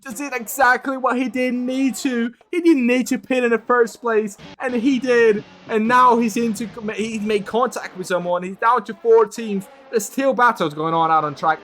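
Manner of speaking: rising, breathless race call; excited commentary. Real, not acted. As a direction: call the chaos at full pitch. Live race crash commentary.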